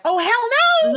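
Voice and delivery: acted outburst, loud and very high; outraged outburst. Co-host imagining herself standing up in court, loud mock outrage. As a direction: blurt it out in mock outrage, drawing out the words. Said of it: "I would have stood up and went".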